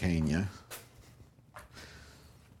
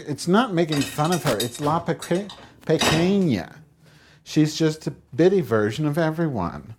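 insistent, higher; 8 semitones higher, 4 dB louder than usual. Retold or imitated. Defending the joke, raised.

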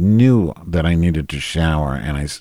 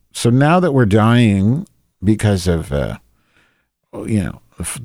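usual speaking voice

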